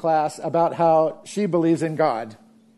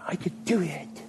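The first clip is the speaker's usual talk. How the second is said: higher, self-confident "I could do it."; boastful self-reliance; 4 semitones higher, 9 dB quieter than usual. Shown as a demonstration. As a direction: brag "I could do it." on your own strength.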